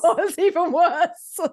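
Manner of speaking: high, slow quote through a laugh. Retold or imitated. To the guest about the move, a high, slow laughing quote. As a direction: laugh it out like a wry joke. Joking about paradise being worse.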